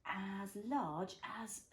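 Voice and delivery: slow, gentle picture book reading; reading to a toddler. A narrator's reading. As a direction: read it slowly for a little one. Reads picture book to child.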